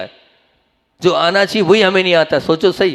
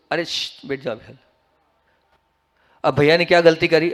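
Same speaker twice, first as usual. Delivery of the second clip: ordinary talk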